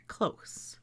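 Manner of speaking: "close" with voiceless final s; close ending in s. Shown as a demonstration. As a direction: say "close" to rhyme with "dose". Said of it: "This one would be".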